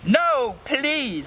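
high-pitched acted plea; beg, pitched well up; begging. A narrator's reading. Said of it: "I begged".

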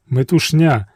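ordinary delivery

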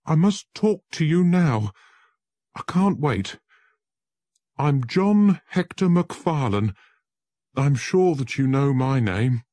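high, loud, urgent and scared; terrified man introducing himself to Holmes; frightened pleading. Acted. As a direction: beg to be heard, panicked. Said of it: "He looked very frightened".

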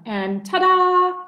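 high, slow, showy reveal; grand ta-da. Acted. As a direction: present it with a flourish.

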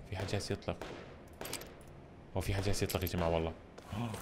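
quiet, careful warning; hushed. Real, not acted. Gamer hearing shots, warning viewers softly. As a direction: drop to a soft cautious voice. Hears gunfire, players nearby.

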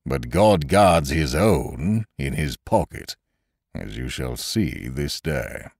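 slow, solemn zealot; fanatical blacksmith. A narrator's reading. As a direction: intone it slowly like a fanatic. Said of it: "the august face of a fanatic".

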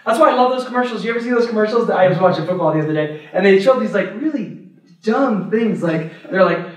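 ordinary talk

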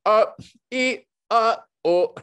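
high, loud broken-up syllables; squeaky loud frame-by-frame grunts; crazy syllable noises. Retold or imitated. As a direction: sound out animation noises like a madman. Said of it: "sounding like a crazy person going like".

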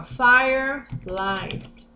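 fireflies drawn out very slowly, stressing the plural; very slow model plural; slow teaching. Shown as a demonstration. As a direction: stretch out fireflies so the plural ending is heard. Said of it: "no plural".

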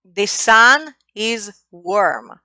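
the sun is warm said slowly, word by word; slow example sentence. Shown as a demonstration. Teacher slowly models an example sentence. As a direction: spell it out slowly for learners.

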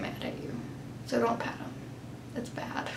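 hushed conspiratorial whisper; whispered warning. Acted. Warning not to pet dogs.

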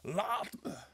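high, loud, agonised cry; pained scream. A narrator's reading. Tormented man pushes Sonja away, screaming. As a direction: shout her away in anguish. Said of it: "schreeuwde hij, verkrampt van smart".

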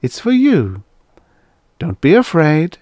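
high, loud, swooping encouragement; coaxing robot. Acted. Robot offers youngster a gift, coaxing. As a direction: coax the child to take it. Robot coaxes a frightened child.

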